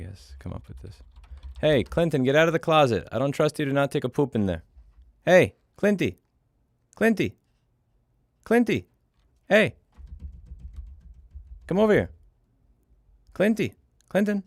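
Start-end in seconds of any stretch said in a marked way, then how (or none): 1.6-4.6 higher, louder telling-off; scolding the cat. Real, not acted. Telling his cat Clinton to leave the closet, loud higher scolding. 5.2-14.4 high, loud repeated calls; calling the cat. Real, not acted. Calling his cat Clinty to come over, high and loud.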